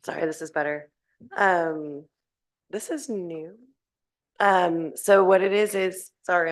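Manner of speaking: hoarse, strained voice; losing her voice. Real, not acted. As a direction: speak through a failing hoarse voice. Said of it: "I'm losing my voice".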